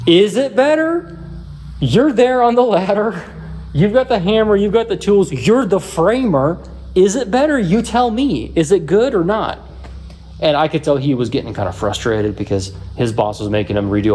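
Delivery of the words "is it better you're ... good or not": much higher, pushing back in disbelief; mock-incredulous, higher voiced challenge to the framer; exasperated retort. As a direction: throw the question back at him, fed up. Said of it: "at that point, I was like".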